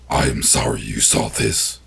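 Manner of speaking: low, slow monster voice. A narrator's reading. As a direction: take it slowly. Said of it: "the thing said slowly".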